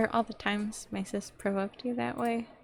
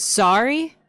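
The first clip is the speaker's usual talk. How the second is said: "Sorry?" mocked, much louder with a high incredulous rise; mocking quote; 4 semitones higher, 12 dB louder than usual. Mocking the sister's one-word apology, loud and incredulous. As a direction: throw her Sorry back with scorn. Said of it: "She just says".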